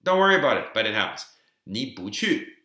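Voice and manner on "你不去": 你不去 with 不 rising as second tone; tone sandhi demo. Shown as a demonstration. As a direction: show 不 going up before 去. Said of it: "it becomes second tone".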